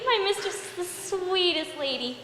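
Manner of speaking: normal talking voice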